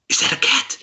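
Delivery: high, loud breathless question; amazed gasp. Acted. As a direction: gasp with delight. Warforged gasps at cat.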